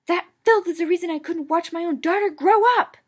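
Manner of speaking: loud, high furious bellow; fierce bellow. A narrator's reading. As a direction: bellow with rage. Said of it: "he bellowed fiercely".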